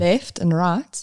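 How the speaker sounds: slow South African demo for a learner; drawn-out South African pronunciation; South African accent. Shown as a demonstration. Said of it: "teach me that word again".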